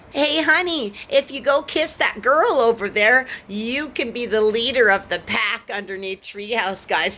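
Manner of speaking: high sugary mock coaxing. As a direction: sweet-talk her in a mocking high voice. Imitating narcissists coaxing.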